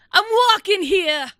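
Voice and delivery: high, loud hammed-up New York accent; mock New York yell. Acts a New York pedestrian yelling.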